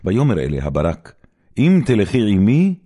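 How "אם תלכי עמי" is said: higher and louder, pressing the condition; pleading condition. Acted. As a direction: push hard on the first word. Barak bargains with Deborah.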